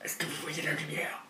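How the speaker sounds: soft, unvoiced radio whisper; eerie whisper. Unknown voice heard over the radio, whispered.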